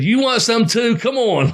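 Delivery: high, amused taunt; mock challenge. Laughing wrestler daring the man on the apron, mock challenge. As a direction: call him out, pitched right up.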